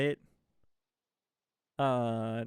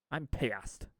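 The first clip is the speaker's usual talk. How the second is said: usual speaking voice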